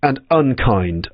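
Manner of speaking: ordinary delivery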